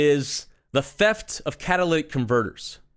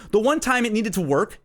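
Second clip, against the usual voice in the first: normal talking voice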